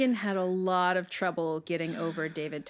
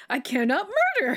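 laughing imitation; 9 semitones higher, 6 dB louder than usual. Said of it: "Or we haven't found a gun yet".

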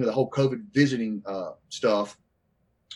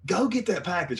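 insistent mother; 6 semitones higher than usual. Retold or imitated.

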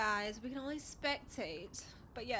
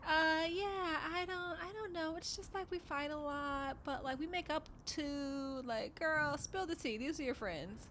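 higher, slow hesitant mock voice; imitating her; 6 semitones higher than usual. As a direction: do her unsure, stalling voice. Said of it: "So she's like".

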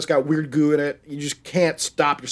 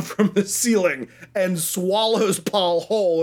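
ordinary talk

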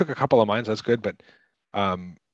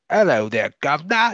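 mock Cockney chimney sweep; bad British accent; 7 semitones higher, 4 dB louder than usual. Friend fakes a British accent to charm girls, hammed-up Cockney. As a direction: ham up a fake British accent. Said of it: "when he faked that British accent".

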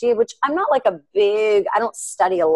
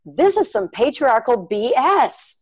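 retold indignant outburst; 6 semitones higher, slower than usual. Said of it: "I really felt this awful spirit come over me".

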